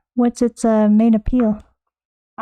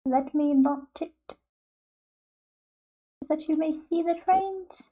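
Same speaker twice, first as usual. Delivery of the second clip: mock formal hush; 3 semitones higher, 10 dB quieter, much slower than usual. Quiet, slow formal phrasing.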